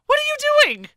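high incredulous. Real, not acted. Mock disbelief at beard.

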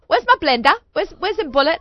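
imitated demanding daughter. Daughter phoning mom about missing blender, mock demanding. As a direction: imitate an exasperated caller hunting for something. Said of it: "she calls me up. She goes".

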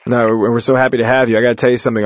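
ordinary talk, nothing marked

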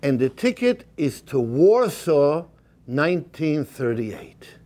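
normal talking voice

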